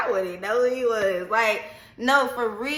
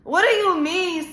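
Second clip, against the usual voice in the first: mama indignant; 8 semitones higher, 4 dB louder than usual. Retold or imitated. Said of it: "My mama go".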